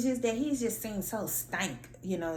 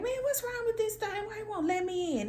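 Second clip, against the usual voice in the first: high-pitched frustrated complaint; a much higher, exasperated voice; frustrated outburst. Retold or imitated. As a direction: whine at the broken door. Retold frustrated muttering at door.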